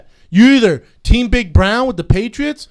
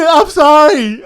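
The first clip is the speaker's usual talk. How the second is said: laughing apology; 7 semitones higher, 7 dB louder, slower than usual. Real, not acted. Laughing apology, high loud.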